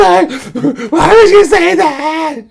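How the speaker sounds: very high, loud wail breaking into laughter; half-laughing drunk wail; mock sobbing. Imitating drunk crying.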